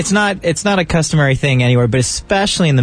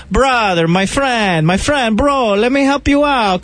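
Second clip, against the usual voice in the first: lightly voiced Middle Eastern salesman; a hint of a pushy vendor's accent; Middle Eastern street seller; 9 semitones higher than usual. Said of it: "and it was always".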